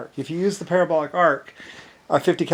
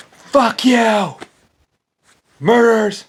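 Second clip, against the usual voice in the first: higher, louder mock outburst of rage; mock angry shout; 7 semitones higher, 4 dB louder, much slower than usual. At the killers of his engineers, mock angry shout. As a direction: hurl it at the killers. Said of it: "I was mad".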